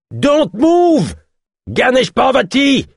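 very high, loud yell, slow on the name; distant yell. A narrator's reading. A pursuer yells at Ganesh over the crowd, loud yell. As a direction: bellow it over the riot from afar. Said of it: "hurla une voix dans le lointain".

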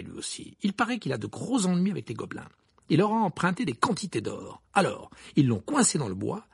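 a touch of animated grievance; aggrieved twin. Acted. Twin complaining to friends about an unpaid bet, lightly voiced indignation.

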